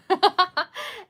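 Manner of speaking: high, loud burst of giggles; real laugh, pitched right up; amused laugh. Real, not acted. Mom joking about calling her kid Drama Queen, laughing loudly. Amused at own nickname idea.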